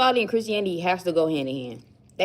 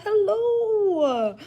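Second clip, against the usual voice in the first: high, slow cutesy imitation; imitating her cute voice; 10 semitones higher, much slower than usual. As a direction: squeak it out sweetly like her. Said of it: "she was like".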